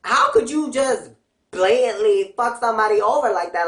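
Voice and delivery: ordinary delivery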